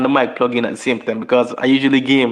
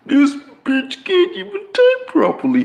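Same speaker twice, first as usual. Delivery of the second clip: scornful. Real, not acted. Mocking a bad speller.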